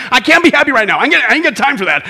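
loud, put-upon complaint; harried Martha. Acted. Too busy with preparations to be happy, loud harried protest.

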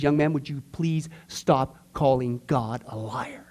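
slow, low and stern rebuke; grave, measured reprimand, low-pitched. Retold or imitated. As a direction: imitate a stern elder, spacing the words out slowly. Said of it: "turned even more stern to the young man".

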